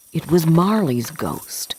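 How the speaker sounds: usual speaking voice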